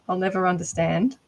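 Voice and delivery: ordinary delivery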